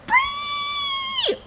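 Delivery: very high, drawn-out squeal; high-pitched voice. Retold or imitated. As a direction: shriek it in a tiny high voice. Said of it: "in high-pitched voice, went".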